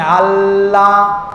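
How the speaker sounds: আল্লাহ very slow, lingering on the double L; pause on L. Shown as a demonstration. Preacher demonstrates the tongue's brief halt, pause on L in আল্লাহ. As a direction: hold the L of আল্লাহ, then a long level vowel. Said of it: "সেখানে একটা বিরতি নেয়".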